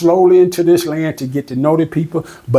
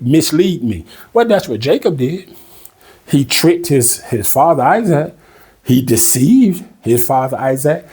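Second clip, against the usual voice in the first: ordinary talk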